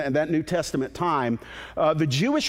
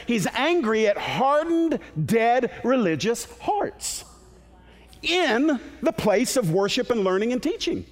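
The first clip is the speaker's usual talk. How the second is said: raised, driving pulpit voice; fiery preaching; 6 semitones higher than usual. Acted. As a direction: preach it with rising fervor. Preaching at full force.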